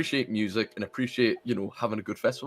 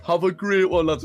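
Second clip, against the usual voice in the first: loud, raised mock Geordie; Geordie farewell; 7 semitones higher, 7 dB louder than usual. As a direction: wave off the lads in Geordie. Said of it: "He was a strong Geordie".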